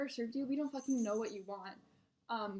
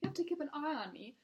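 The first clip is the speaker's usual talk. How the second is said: higher mock British customer; British accent; 6 semitones higher than usual. Regular wants server watching him, mock British accent. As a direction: imitate a needy British diner. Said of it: "he has a British accent".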